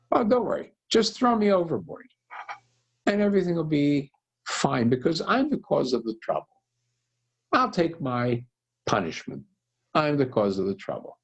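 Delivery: Jonah offers himself up, urging them not to worry; resigned Jonah. Acted. Jonah tells sailors to throw him.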